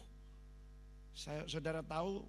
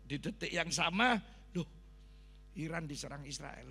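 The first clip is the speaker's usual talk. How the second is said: ordinary delivery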